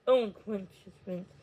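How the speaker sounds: plain reading voice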